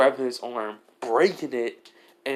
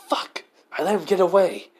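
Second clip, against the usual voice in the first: shouted frustrated curse; angry curse; 6 semitones higher, 5 dB louder than usual. Acted. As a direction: yell it out, furious he got away.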